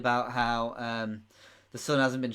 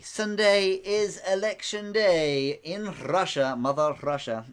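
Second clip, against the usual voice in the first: slow, hammed-up Russian accent; bad Russian accent; 5 semitones higher, slower than usual. Quizmaster revealing election day in a mock Russian accent. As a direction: put on a terrible Russian accent, drawn out. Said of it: "That's probably a terrible accent".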